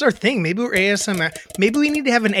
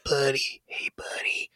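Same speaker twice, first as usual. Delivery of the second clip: hushed hillbilly whisper, drawn out; mock ASMR porn whisper in a hillbilly voice; 4 dB quieter than usual. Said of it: "I turned in, like, a hillbilly".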